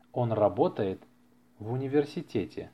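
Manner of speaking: usual speaking voice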